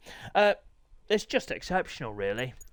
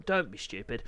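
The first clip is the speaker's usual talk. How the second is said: ordinary talk, nothing marked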